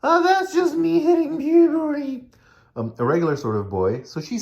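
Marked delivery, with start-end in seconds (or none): 0.0-2.3 put-on high squeaky boy voice, louder; David, very high and squeaky. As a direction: squeak like a voice cracking with puberty. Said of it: "this squeaky voice".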